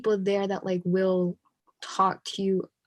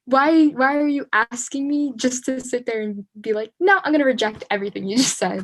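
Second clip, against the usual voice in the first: exasperated friend; 5 semitones higher, 5 dB louder than usual. Retold or imitated. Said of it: "And they're like".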